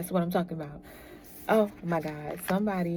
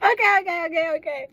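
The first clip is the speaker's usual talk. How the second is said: much higher, louder laughing okays; 11 semitones higher, 7 dB louder, faster than usual. Real, not acted. Tarot reader catching breath after laughing. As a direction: gasp out the okays through laughter.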